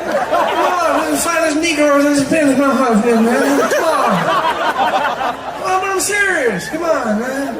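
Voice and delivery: high, loud, slurred protesting; Joe Biden. Acted. President insisting he is serious, high mumbling impression. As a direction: insist emphatically through a mumble. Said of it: "that weird mumbling thing".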